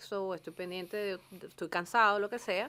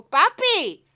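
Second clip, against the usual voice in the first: put-on louder and higher, teasing Maracucho accent; 8 semitones higher, 15 dB louder than usual. Teasing Carlos by talking Maracucho, playful Maracucho accent. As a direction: joke with him in a thick Maracucho accent. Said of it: "le empiezo a hablar maracucho".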